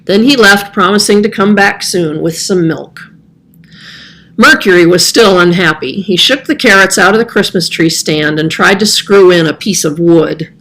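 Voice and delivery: ordinary delivery, nothing marked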